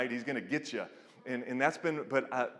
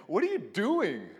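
incredulous; 7 semitones higher, 4 dB louder than usual. Retold or imitated. Jesus rebuking disciples.

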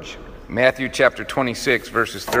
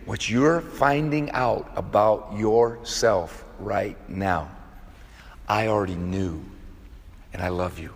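very slow, every word drawn out, "you're" leaned on; slow, deliberate repeat; 2 semitones lower, 4 dB quieter, slower than usual. Shown as a demonstration. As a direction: spell it out slowly for emphasis. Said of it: "I'm gonna say it again".

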